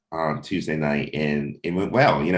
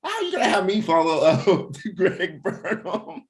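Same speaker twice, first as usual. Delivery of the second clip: much higher, laughing through the mock complaint; laughing protest; 13 semitones higher than usual. Host imitating his guest's laughing protest, high pitched. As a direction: squeal it out between laughs in disbelief.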